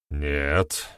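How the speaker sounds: low, cold refusal; hard and cold. A narrator's reading. Clan head answers whether anyone believes him, hard and cold. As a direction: shut it down coldly. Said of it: "жёстко и холодно произнёс".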